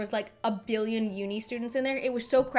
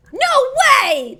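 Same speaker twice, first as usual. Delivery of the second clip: high, loud, drawn-out exclamation; astonished shriek; shocked disbelief; 11 dB louder than usual. Real, not acted. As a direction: cry out in disbelief. Disbelieves the slang phrase.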